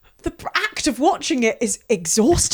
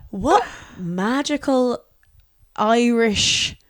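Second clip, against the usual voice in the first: slow, half-Scottish attempt at Irish; drawn-out words in a put-on Scottish accent; attempted Irish accent slipping into Scottish. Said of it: "I said it in a Scottish accent".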